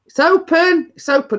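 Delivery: high-pitched mock call to the door; retold shout. Homeowner calls to expected visitor at the door, mock shouting. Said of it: "So I'm shouting".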